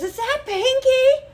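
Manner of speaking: high, slow, wide-eyed fan voice; surprised fan. Retold or imitated. Passing guy recognizing her, high and amazed. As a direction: squeak it like a starstruck stranger. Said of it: "he goes".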